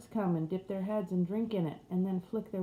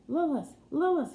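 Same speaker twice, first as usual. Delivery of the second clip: much higher and louder, urgent call; 9 semitones higher, 6 dB louder than usual. Acted. Husband calling back to his wife's voice, urgent. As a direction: cry out to her across the dream.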